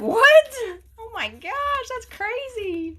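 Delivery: very high, loud shocked cry. Retold or imitated. Reacting to a viewer who would drive eight hours, shocked high cry. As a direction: squeak out the disbelief, fading. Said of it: "And I was like".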